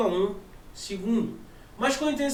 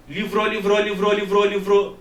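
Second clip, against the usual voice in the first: LIVROU chant building louder; intensified echo; 6 dB louder than usual. Shown as a demonstration. Teacher demonstrating sound intensity to the class, loud chant. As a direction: repeat LIVROU on one note, growing forceful. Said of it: "Olha a intensidade do som".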